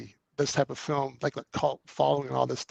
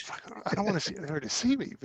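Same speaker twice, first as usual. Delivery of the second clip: panicked hushed aside; 5 semitones higher, 9 dB quieter than usual. Retold or imitated. Hiding from [name].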